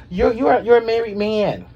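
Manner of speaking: rising indignation; scolding. Acted. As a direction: call him out. Scolding Irv Gotti.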